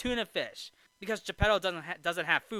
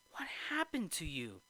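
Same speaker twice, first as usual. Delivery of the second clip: soft mock dismay; hushed imitation of a startled father; Geppetto; 9 dB quieter than usual. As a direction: ask it quietly, as if taken aback. Geppetto shocked, acted.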